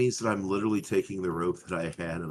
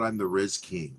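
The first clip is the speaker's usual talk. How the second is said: normal talking voice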